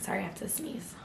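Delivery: quick whisper before a sneeze; hushed, breathless whisper. Real, not acted.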